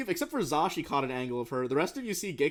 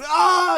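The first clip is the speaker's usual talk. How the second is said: scream; 11 dB louder than usual. Acted. Victim's mind overloaded.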